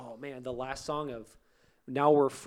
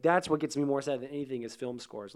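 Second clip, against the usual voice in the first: normal talking voice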